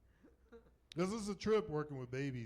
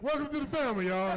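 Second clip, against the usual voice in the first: loud, high, jubilant mock greeting; hearty welcome shout; 12 semitones higher, 10 dB louder than usual. Nurse welcoming the baby's parents into the family, joyfully hollered. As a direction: holler it like a celebration.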